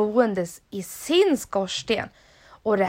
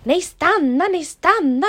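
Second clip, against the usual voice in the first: high, loud pleading call; the little creature calling after the fleeing dad; 7 semitones higher, 6 dB louder than usual. Acted. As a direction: call after him to stop. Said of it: "ropade varelsen".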